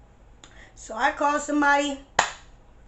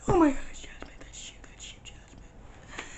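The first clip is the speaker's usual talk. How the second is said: hushed relief at finding her friend; relieved whisper; 3 semitones higher, 8 dB quieter than usual. Retold or imitated. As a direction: breathe it out, whispering.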